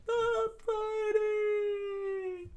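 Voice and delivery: very high, long-drawn falsetto scream; acted princess shriek from above; Vivi. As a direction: stretch the scream out slowly. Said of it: "Vivi screamed out".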